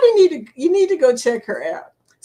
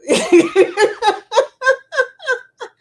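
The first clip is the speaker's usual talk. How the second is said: big high laugh; 13 semitones higher, 6 dB louder than usual. Real, not acted. Friend's joke makes her burst out laughing.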